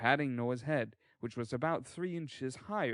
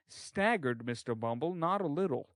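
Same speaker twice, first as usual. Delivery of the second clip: plain reading voice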